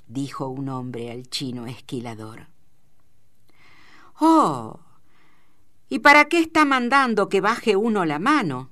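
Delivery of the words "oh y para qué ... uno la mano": high, loud, indignant comeback; defiant retort. A narrator's reading. Shearer talking back to the man scolding him, defiant. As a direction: answer back with cheek, voice pitched right up.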